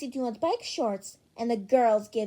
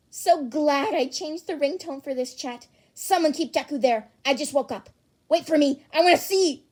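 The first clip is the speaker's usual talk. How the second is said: excited; 2 semitones higher, 7 dB louder than usual. Acted. Excited classmate begs to see.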